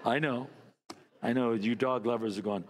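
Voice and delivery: ordinary talk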